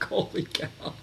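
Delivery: stunned, voiceless whisper; hushed amazement. Retold or imitated. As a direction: breathe it out softly, as if floored. Retold stunned reaction to the revelation.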